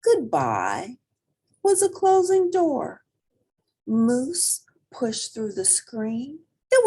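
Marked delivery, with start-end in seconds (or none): none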